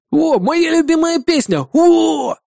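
high squeal like a pop fangirl; squealing fan. A narrator's reading. Bartender hearing his favourite song, squealing like a fan. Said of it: "заверещал, словно типичная фанатка би ти эс".

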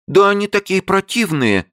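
very high exclaiming; exclamation. A narrator's reading. Li exclaims to Abe about the creatures. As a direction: exclaim in disgust. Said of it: "воскликнула Ли".

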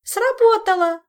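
very high, loud joyful squeal; squealing Zin. Acted. Zin seeing the kiss work, joyful squeal. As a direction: shriek it in delight. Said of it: "радостно взвизгнула Зинь".

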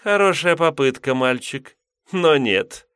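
high, teasing demoness voice; mocking. A narrator's reading. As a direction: toy with him, amused. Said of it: "насмешливо прищурилась суккуба".

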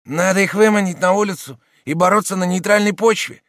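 little schemer. A narrator's reading. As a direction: pitch it up as a wily little man. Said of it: "говорил маленький проныра".

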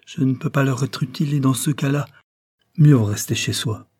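a loud, high, firm no; emphatic spinster refusing. A narrator's reading. Cornélie refusing Joyce, loud and firm at first. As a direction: refuse sharply, then settle.

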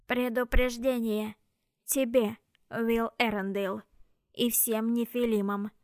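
slow, grinding machine voice; metallic robot voice. Acted. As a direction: speak like a broken grinding machine. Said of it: "как скрежет неисправной машины".

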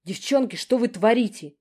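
loud, high reprimand; scolding. A narrator's reading. Narrator scolding the fighting sisters, loud reprimand. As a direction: stop the fight sharply.

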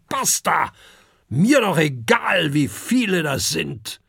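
building to a high, loud rant; surly dragon tirade. A narrator's reading. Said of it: "sieht er mürrisch zu Kokosnuss". (mürrisch, dann lautstark polternd)

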